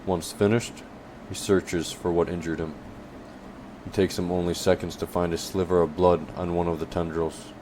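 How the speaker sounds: plain reading voice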